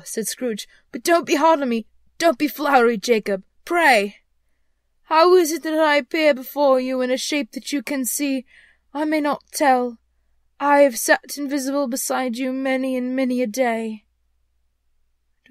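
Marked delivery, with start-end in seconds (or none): 0.9-4.2 pleading. Acted. Scrooge pleads with the ghost. 5.0-14.0 high, loud spectral declaiming; solemn ghost. Acted. Voices the ghost in the story.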